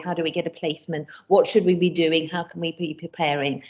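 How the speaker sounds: ordinary talk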